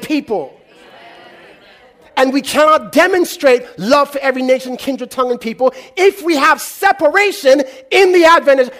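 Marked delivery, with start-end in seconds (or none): none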